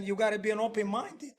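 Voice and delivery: usual speaking voice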